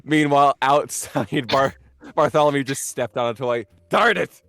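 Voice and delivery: loud narration. Acted. GM narrates a loud stumble scene.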